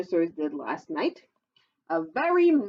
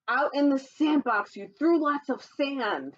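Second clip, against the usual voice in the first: lively sing-song storybook read, higher; reading to children; 7 semitones higher than usual. Acted. Reading a dinosaur picture book to children, sing-song storytelling voice. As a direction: read the rhyme brightly to little listeners.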